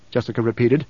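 ordinary delivery

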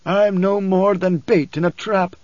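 high, slow, bitter preacher. A narrator's reading. Preacher to Leto about being bait, understated bitterness. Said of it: "his voice was bitter".

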